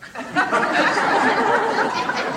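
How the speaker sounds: a sudden high laugh; high burst of laughter. Real, not acted. Teller laughing at his own banana joke.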